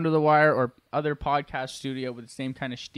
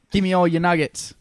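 louder, mock English accent customer; 3 semitones higher, 5 dB louder than usual. Robber demanding nuggets at an English McDonald's, mock English accent. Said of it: "give it one more go in English".

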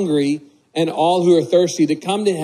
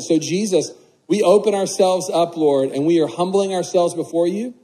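prayer. Acted. Leads the congregation in prayer.

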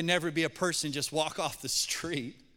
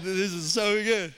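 gleeful; 2 semitones higher, 6 dB louder than usual. Retold or imitated. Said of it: "and I said".

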